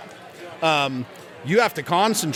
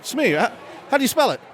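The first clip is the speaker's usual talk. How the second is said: higher-pitched Australian presenter; Australian radio host; 7 semitones higher than usual. Acted. Host quizzing second caller, high Australian accent. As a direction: query the word in an Australian accent. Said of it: "this Australian radio station's holding a contest".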